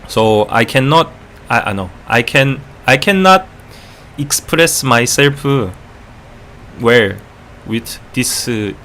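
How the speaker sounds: halting English in a Korean accent; Korean-accented English, added vowels on word endings. Shown as a demonstration. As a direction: put on a thick Korean accent, searching for words. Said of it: "with the Korean accent".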